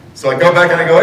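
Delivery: ordinary talk, nothing marked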